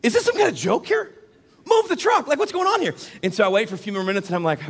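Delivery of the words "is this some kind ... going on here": high-pitched, incredulous mock yell; hammed-up frustrated passenger; exasperated outburst. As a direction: blow up in comic disbelief at the delay. Acted outburst at the delay.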